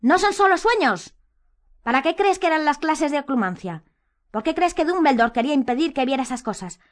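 high-pitched, insistent outburst; heated arguing. A narrator's reading. Harry argues heatedly with Hermione.

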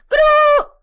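very high, very loud cry; shouted spell. A narrator's reading. Umbridge screaming the curse at Harry. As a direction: shriek the curse. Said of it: "inspiró hondo y gritó".

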